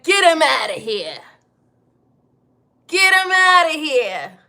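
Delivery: loud mock old Italian mobster; loud, hammed-up mob boss order. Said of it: "I'ma sound like an old Italian mobster".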